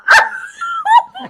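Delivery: shrieking laugh. Real, not acted. Speaker laughs at the bite.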